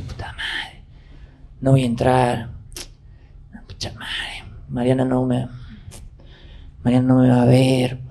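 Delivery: put-on boy on the bench fearing the girl won't see; whispered despair. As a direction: whisper it to yourself in dread. Despairing inner thought, whispered.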